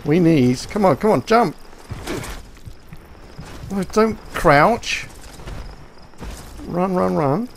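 high panicked urging, fast and loud; running for your life, frantic; being chased. Real, not acted. Fleeing enemy, urging himself on.

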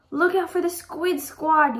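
high, drawn-out warning yell. Acted. Friend warns Arthur during the game, yelling. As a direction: call out the danger, loud and high. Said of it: "yelled Buster".

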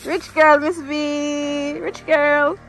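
ordinary delivery

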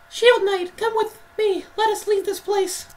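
very high, squeaky hero voice; high-pitched little knight; knight's character voice. Acted. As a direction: read the plea in tiny falsetto. Voices a game character's dialogue.

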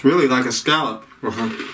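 words muffled by food; talking while chewing. Real, not acted. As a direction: mouth full of cracker. Seen 0: Bites cracker at 2.6, then visibly chews while speaking.

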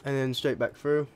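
normal talking voice

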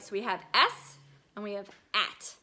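ordinary delivery

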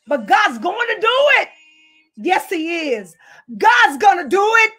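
a preacher rising to full force; shouting preacher. Acted. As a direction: shout the promise out high. Escalating preaching.

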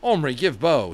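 a loud, curt order; commanding. A narrator's reading. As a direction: order the boy about. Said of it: "he commanded".

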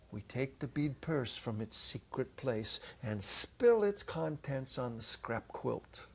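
usual speaking voice

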